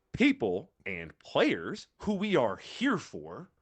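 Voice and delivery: very slow, word-by-word proclamation; deliberate, drawn-out declaration; mock official address. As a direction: spell it out slowly like a pretend official statement. Voicing the PGA addressing its players.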